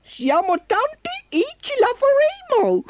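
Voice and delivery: tiny, very high character voice; encouraging little car. Acted. Little car rallying the others to lift Ford, squeaky encouragement.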